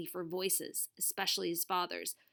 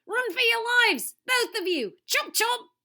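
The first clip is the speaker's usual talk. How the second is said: put-on high, loud Australian-accented alarm; panicked shout; 8 dB louder than usual. TV star warns both to flee, panicked shout. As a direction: shout them away in hammed-up panic. Derek shouting warning from tree.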